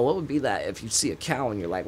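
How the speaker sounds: normal talking voice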